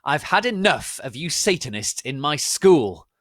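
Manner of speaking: loud high shout at the students; hammed-up headmaster shout. A narrator's reading. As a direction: bellow it furiously at the school. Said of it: "shouted Dumbledore spuriously".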